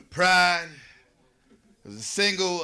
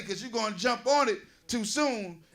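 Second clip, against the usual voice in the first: ordinary talk, nothing marked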